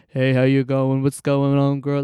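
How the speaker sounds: low, loud put-on blokey greeting; hammed-up masculine voice, dropped in pitch. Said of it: "deeper voice or a more masculine sounding voice".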